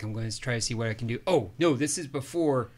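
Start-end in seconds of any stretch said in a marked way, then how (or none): 0.0-1.2 rushed reply. Retold or imitated. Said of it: "He's like".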